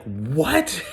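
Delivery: high, laughing "what?"; retold disbelief. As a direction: squeak it out in amused shock. His reaction to the reveal.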